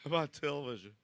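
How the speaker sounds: high, loud mock excitement; hammed-up gleeful show-off; mocking imitation. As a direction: mimic someone thrilled to be on camera. Mocks man grinning on camera.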